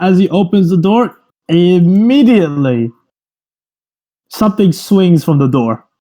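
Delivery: usual speaking voice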